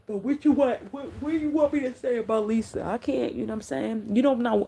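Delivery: high-pitched, exasperated protest; real exasperation. Real, not acted. Streamer answering a viewer about Lisa, high exasperated plea. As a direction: push the pitch right up, at a loss.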